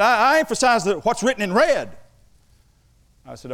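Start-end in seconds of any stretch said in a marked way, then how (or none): 0.0-2.0 high, loud imitation of an earnest young student; mock an eager student's protest; raised-pitch, insistent student voice. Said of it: "one student, he's nineteen years old".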